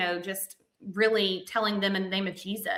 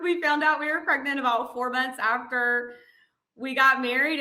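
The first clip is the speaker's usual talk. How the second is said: usual speaking voice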